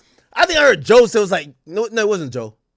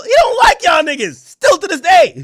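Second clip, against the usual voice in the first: higher and louder, hammer it home; emphatic shout; 9 semitones higher, 4 dB louder than usual. Real, not acted. Ranting at media critics about [name], loud emphatic shout.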